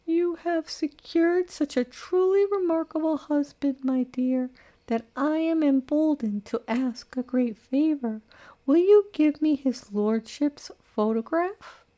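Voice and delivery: high, prim matron voice dripping with sarcasm; sweetly barbed parting shot; bitter sugary old lady. Acted. Old lady's bitter parting words.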